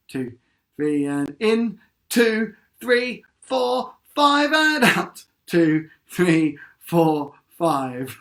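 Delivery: slow counted breaths; breathing count. Shown as a demonstration. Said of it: "sitting up nice and straight".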